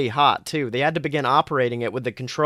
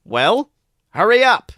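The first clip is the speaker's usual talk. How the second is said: much higher, louder snap; impatient order; 8 semitones higher, 7 dB louder than usual. A narrator's reading. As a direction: bark at him to hurry. Kennedy orders him on.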